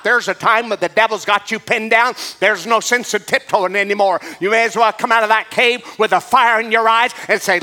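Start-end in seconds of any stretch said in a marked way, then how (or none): none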